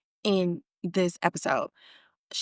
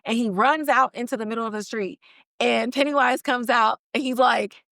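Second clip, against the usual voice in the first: ordinary talk, nothing marked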